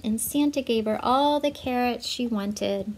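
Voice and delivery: usual speaking voice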